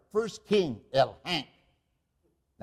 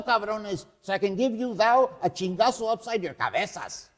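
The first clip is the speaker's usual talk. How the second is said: blustering fat rogue; 6 semitones higher, 6 dB louder than usual. Acted. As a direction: roar like a drunken thief king. Fausto wakes up roaring.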